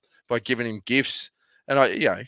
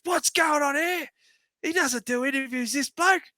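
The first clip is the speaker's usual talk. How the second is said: much higher, slow teasing impression; mock Luke Rowe. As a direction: tease him in a squeaky voice. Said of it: "he goes".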